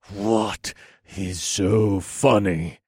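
slow, tight, contained anger; seething, drawn-out question; through gritted teeth. A narrator's reading. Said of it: "I asked through gritted teeth".

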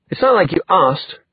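high-pitched defensive retort; mildly scornful comeback. Acted. Scornful reply in quarrel.

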